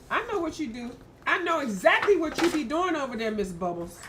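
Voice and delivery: teasing the pet; mock-stern talk to a cat; playful scolding. Real, not acted.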